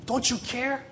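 very high, pleading question; distressed plea. Acted. Disciples pleading with sleeping Jesus, distressed. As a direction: plead in a high voice without shouting.

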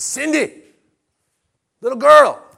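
high, loud call of a name. Acted. Jesus calling to little girl Cindy, loud and high. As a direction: call her like across a field.

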